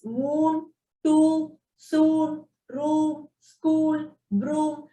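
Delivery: "moon, to, soon, room, school, broom" each with a long level oo; elongated oo. Shown as a demonstration. Teacher reading long oo examples, each vowel in "moon, to, soon, room, school, broom" elongated. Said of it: "So you just elongate the word oo".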